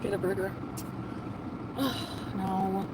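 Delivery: ordinary talk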